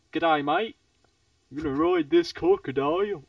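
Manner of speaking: broad mock Australian accent. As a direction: do a chummy Aussie greeting and boast. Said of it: "it was an Australian".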